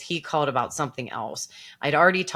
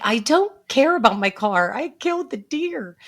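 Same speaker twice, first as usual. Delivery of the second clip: high, loud-starting wail; hammed-up anguish, rising higher to the end; distraught protest; 8 semitones higher, 4 dB louder than usual. Acted upset reply to dispatcher.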